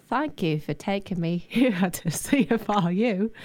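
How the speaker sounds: put-on Cockney-sounding accent, a laugh breaking through; an Australian attempt that drifts into Cockney; Cockney-ish accent. Guest thanks the station in a mock accent, Cockney-ish. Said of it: "No, that's more Cockney".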